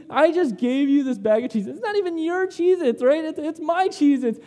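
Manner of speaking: lightly hammed-up indignant complaint; mock indignation. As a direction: argue your case in playful exasperation. Acted protest to daughter.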